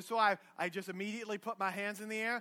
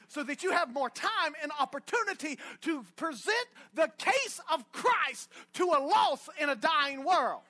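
fiery preaching; 5 semitones higher than usual. Real, not acted. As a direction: preach it out to the crowd. Preaching at full force.

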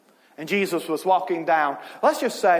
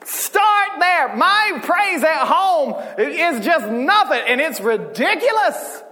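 high, raised-voice preacher's exhortation; excited pulpit delivery, pitched up; fired-up preaching; 7 semitones higher, 3 dB louder than usual. Real, not acted. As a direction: preach it with rising fervor. Preaching at full force.

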